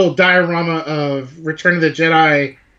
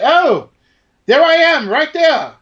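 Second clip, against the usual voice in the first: high, lilting imitated accent; Ian McDiarmid's accent; 11 semitones higher, 4 dB louder, slower than usual. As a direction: pitch it way up, delighted, in his accent. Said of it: "in his accent, he was like".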